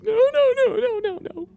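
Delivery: very high, panicked pleading no; terrified pleading. Acted. Captive in the dark cellar begging, high terrified pleading. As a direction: beg in terror, voice way up. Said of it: "you can hear one person just like".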